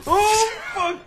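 high panicked shriek; startled scream. Real, not acted. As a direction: shriek in sudden fright. Sudden scare in game.

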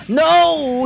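high, loud cry of no; anguished shout. Real, not acted. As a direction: yell it as the kill slips away. Combo fails at the end.

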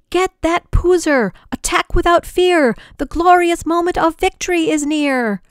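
rally the troops into the attack; general's battle yell. A narrator's reading. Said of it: "Then he yelled".

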